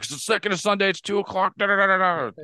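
high, rattled-off dazed player voice; dazed concussed player. Retold or imitated. Concussed player rattling off answers to the trainer, high and dazed. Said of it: "You're like".

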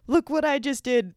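high-pitched imagined triumphant cry; excited inner exclamation. Retold or imitated. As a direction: show off, voice jumping up high. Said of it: "I wouldn't scream this out loud".

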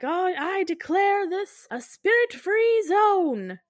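high-pitched, drawn-out grand announcement; mock proclamation. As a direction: declare it grandly, high and slow. Ghost hunter proclaiming in the story.

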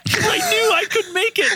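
high, tearful retold wail; hammed-up crying voice; mock crying. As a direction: wail it in comic despair. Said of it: "I fucking started to cry".

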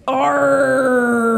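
loud high pirate "R"; pirate arr. Acted.

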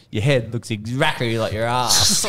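slow, loud, laughing through it; punchline breaking into laughter. Real, not acted. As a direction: crack up while saying it. Doctor delivers punchline laughing.

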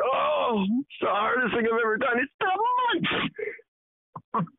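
high, hammed-up whimper; mock tearful contestant. Reality show contestant missing home, mock sobbing. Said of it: "It's like these fucks on Survivor".

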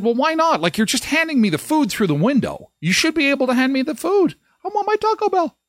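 high whiny complaint, rising to a wail on "my Taco Bell"; spoiled child whining. Retold or imitated. To the drive-thru worker, a whiny spoiled-child complaint. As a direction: whine like a kid denied his treat. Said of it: "Like a spoiled child".

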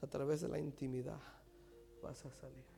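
low, hushed, sinking into a whisper; God speaking softly. Acted. God calling Elías out of the cave, hushed. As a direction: call gently, fading to a whisper. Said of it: "Dios le dijo a Elías".

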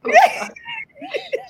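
real high-pitched laughter; squealing laugh. Real, not acted. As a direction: laugh hard, high and loud. Laughing at own joke.

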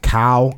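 "cow" said with one Thai tone; Thai tone demo. Shown as a demonstration. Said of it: "they might sound the same".

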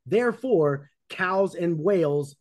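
normal talking voice

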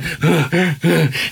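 imitated panting after getting up; breathy huffs, catching the wind; heavy breathing. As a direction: puff out recovering breaths. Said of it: "and I'm breathing".

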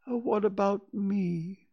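high, slow, plaintive; forlorn, drawn-out question; sad. A narrator's reading. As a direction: ask as if left out. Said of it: "said Pooh sadly".

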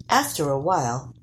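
usual speaking voice

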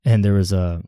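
ordinary delivery

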